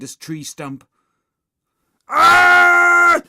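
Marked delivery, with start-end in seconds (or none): 2.1-3.2 piercing high, loud scream; terrified shriek. A narrator's reading. Nervous boy seeing werewolf, shrieking. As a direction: scream out in sudden terror. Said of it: "shrieked anxious Andrew".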